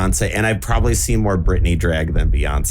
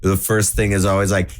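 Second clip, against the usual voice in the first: ordinary delivery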